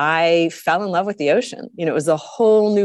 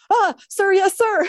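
much higher, squeaky nervous yes-sir; flustered; slower than usual. Retold or imitated. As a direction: play a startled student caught out, voice jumping up. Flustered young self answers official.

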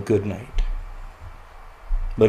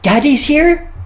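put-on much higher and louder, a delighted young son; son's thrilled surprise that Dad is up; excited little boy; 8 dB louder, slower than usual. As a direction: squeal it like a kid who just heard Dad is home. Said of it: "He goes".

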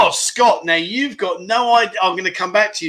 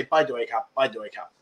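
quiet thai phrase; 7 semitones lower, 8 dB quieter, faster than usual. Shown as a demonstration. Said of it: "You say".

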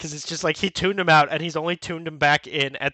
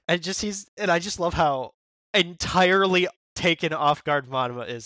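ordinary delivery

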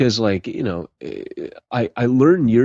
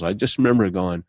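ordinary delivery

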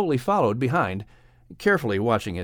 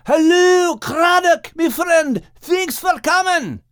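much higher, louder Scottish call; 6 dB louder, slower than usual. A narrator's reading. Max calling out to his whale friend, glad shout. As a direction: hail the whale across the water.

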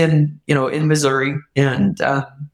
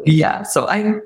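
normal talking voice

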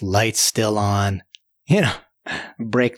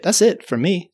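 plain reading voice

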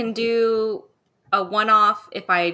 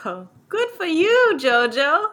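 high loud teasing praise; hammed-up tearful cheer; mock congratulations; 9 semitones higher, 5 dB louder than usual. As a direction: fake sobbing pride. Teasing reply to girlfriend news.

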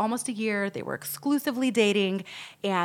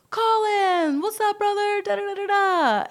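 high, loud hammed-up greeting; hailing a buddy; 12 semitones higher, 5 dB louder than usual. Mafia-looking dudes greeting Colin in the restaurant, mock hail. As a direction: call out like a rowdy pal, pitched way up.

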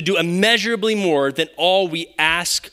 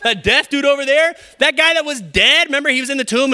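mock boastful Jesus; 7 semitones higher, 3 dB louder than usual. Continuing the boastful Jesus bit.

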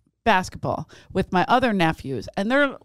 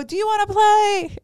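much higher and louder forced cheer; nervous high invite; 12 semitones higher, 4 dB louder than usual. Retold or imitated. Woman nervously inviting the stranger to play, much higher. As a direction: invite him brightly to cover the awkwardness.

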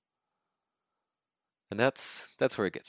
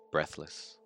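ordinary talk, nothing marked

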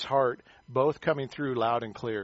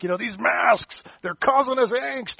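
slow, much higher, louder mock outrage; mock fired-up; 10 semitones higher, 6 dB louder than usual. Pastor retelling his rant at the meeting, mock outrage. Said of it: "I was all fired up".

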